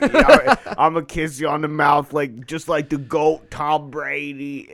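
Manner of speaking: drawn-out Boston bro; slow Boston accent, trailing off. Retold or imitated. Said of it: "But I was doing this Boston accent".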